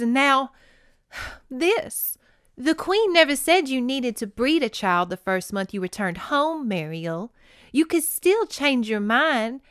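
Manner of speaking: acted-out audiobook dialogue; dramatic story reading. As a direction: read the dialogue aloud with theatrical earnestness. Reads novel dialogue aloud.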